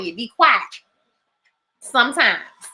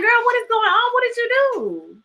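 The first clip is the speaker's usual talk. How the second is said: high, disbelieving what-happened; pitched way up in mock alarm at her sister; incredulous girl; 12 semitones higher than usual. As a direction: friend asking, shocked, why she's upset. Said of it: "That's why I was like".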